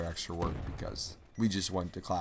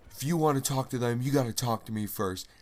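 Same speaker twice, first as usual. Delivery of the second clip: imitating angry dad; 4 semitones higher than usual. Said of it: "and he says".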